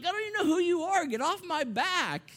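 high, loud, playful protest; mock indignant yell. Uncle to unknown kid on his back, mock indignant. Mock scolding a child climbing on him.

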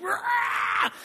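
put-on sudden high shrieked "Rah!" like a furious toddler; imitated toddler scream. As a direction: mimic a child's tantrum scream. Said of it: "She'd start screaming".

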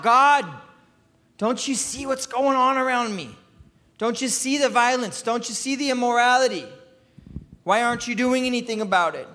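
high, pleading outcry to God; crying out. Acted. Habakkuk surrounded by junk in the world, crying out. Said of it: "and he cries out".

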